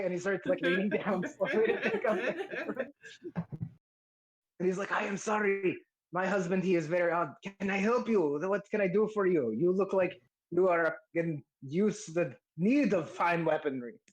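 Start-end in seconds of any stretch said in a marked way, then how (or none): 4.9-14.0 courteous shopkeeper, slightly raised pitch; apologetic smith. Acted. Smith apologizes for husband and offers help, courteous character voice. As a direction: play an accommodating smith greeting a customer.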